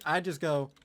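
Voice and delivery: ordinary talk, nothing marked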